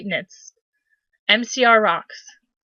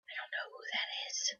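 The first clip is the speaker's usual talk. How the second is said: a hushed whisper, much softer; a quick confiding whisper mid-story; whispered aside; 23 dB quieter than usual. Real, not acted. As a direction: murmur it to yourself, barely voiced. Whispered aside during reading.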